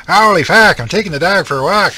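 high, loud hammed-up East Coast Canadian yell on "I'm taking the dog for a walk"; loud East Coast Canadian accent. Shown as a demonstration. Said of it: "that's the East Coast Canadian accent".

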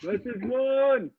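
high, drawn-out call; playful high-pitched calling out; mock broadcast yell. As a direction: echo the broadcast in a stretched high holler. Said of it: "Yell it out right now".

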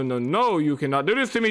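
normal talking voice

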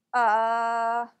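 long, relaxed "uh", like not knowing the answer; relaxed schwa. Shown as a demonstration. Teacher imitating someone unsure of an answer, relaxed schwa. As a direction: draw out "uh" as a hesitant schwa. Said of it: "it's a soft, relaxed sound".

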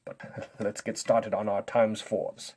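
normal talking voice

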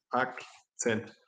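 Akzent said the Swiss German way; a light Swiss German accent on Akzent. Shown as a demonstration. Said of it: "in der Schweiz ... sprechen die Leute Akzent".

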